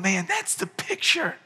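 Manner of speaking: overcome, voice rising in tears; weeping. Retold or imitated. Pastor recalling weeping at the adoption, choked up. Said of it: "And I'm just weeping".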